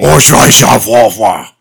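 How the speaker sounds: put-on German accent. Mock German exclamation.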